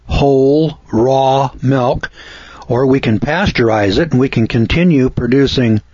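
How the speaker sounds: normal talking voice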